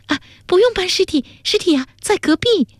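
high, excited blurting; very high, delighted and eager; overjoyed. A narrator's reading. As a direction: tumble out the discovery, pitched high. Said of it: "他过于惊喜".

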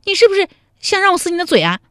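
put-on high, fast furious young woman; angry threat. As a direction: glare and threaten him, shrill with anger. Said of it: "陶三春将杏眼一瞪说". (瞪眼怒斥)

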